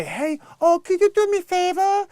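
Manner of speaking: very high, mocking flirty-favor voice; ridiculous high-pitched pleading; stupid silly voice. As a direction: do a silly squeaky imitation of her. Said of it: "you have that stupid, silly voice".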